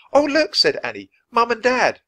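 put-on a girl pointing out her parents, high and loud; excited Annie. A narrator's reading. Annie interrupting Jack, high and excited.

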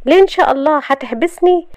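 high, indignant challenge; edgy, irritated. A narrator's reading. Shahd challenging Youssef's ban on going out, irritated. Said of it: "قالت بعصبية".

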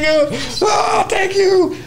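high, drawn-out starstruck cry; acted fan squeal of gratitude; retold overjoyed thanks. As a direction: stretch out the thanks in giddy disbelief. Overwhelmed on seeing his bass hero.

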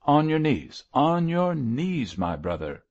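high, ringing command, dropping at the end; trumpet tones. A narrator's reading. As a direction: cry it out like a trumpet call. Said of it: "he cried in trumpet tones".

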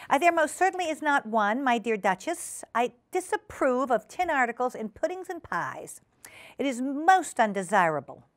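high, prim disapproval; disapproving hostess Ribby. Acted. Ribby objecting to tin in pies, prim disapproval. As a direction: insist primly, pitched well up.

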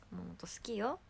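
whispered aside. Real, not acted. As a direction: breathe it out as a secret. (囁いて言う)

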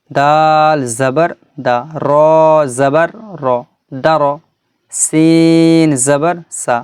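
letter name held long and level, last syllable short; slow, measured spelling chant; Quran primer recitation. Shown as a demonstration. Teacher models Arabic letters for students, drawn-out recitation.

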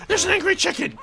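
very high squealed alarm; panicked shriek. Real, not acted. Gamer spotting an angry chicken after him, panicked shriek. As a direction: shriek it in sudden comic panic.

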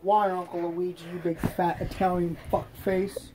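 soft, put-on bratty kid voice; lightly voiced rude kid; Jeffy puppet voice. Puppet Jeffy insults his uncle.

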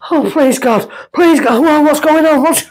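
high-pitched, pleading panic; retold panic. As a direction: act out a desperate silent prayer in a panic. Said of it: "I was panicking".

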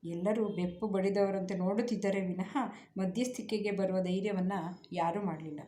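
ordinary delivery

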